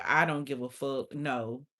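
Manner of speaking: low, offhand brush-off; mock indifference, low; low flat dismissal. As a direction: shrug it off, deep and flat. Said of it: "You like".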